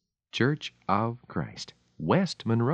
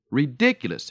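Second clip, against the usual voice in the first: higher, louder outburst; exclaiming; 5 semitones higher, 6 dB louder than usual. Acted. Hitler to Churchill at the tea table, a loud indignant exclamation. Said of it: "exclaimed Hitler".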